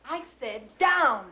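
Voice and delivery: slow, firm and loud; stern command. Acted. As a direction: lay down the law slowly. Mother commands angrily.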